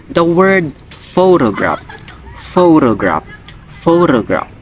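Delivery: photograph with the first syllable PHO stressed, long clear o. Shown as a demonstration. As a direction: hit PHO in photograph, saying it "FOH-to-graph". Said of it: "If you pronounce it correctly".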